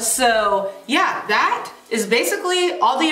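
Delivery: speaking with gold grill; wearing a teeth grill. Real, not acted. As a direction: metal cover on teeth. Seen 1: Gold grill visible on her teeth when mouth opens throughout.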